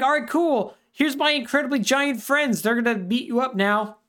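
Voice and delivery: high mock-cheery villain voice; villain taunt. Fake Horace gloats at the boy, high mock-cheery voice.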